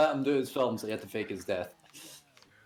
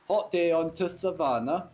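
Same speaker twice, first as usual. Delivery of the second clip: a raised, hammy English line; mock English accent; 5 semitones higher than usual. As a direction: put on a cheesy English accent. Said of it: "I'm trying to do an English accent".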